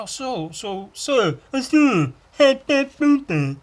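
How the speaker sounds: high, slowing, then shouted hey hey; mock stranger voice. Stranger at a party, hammed-up high voice.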